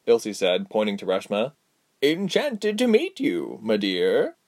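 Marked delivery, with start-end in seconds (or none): 2.0-4.4 high, slow, courtly greeting; drawn-out, high-pitched old lady voice; gracious old lady. Acted. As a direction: greet her as a charmed old dame. Old lady greets a new acquaintance.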